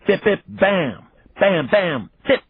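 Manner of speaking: high punchy bips and bams; boxing sound effects. A narrator's reading. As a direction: make playful punch noises. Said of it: "creating a vivid picture out of sound".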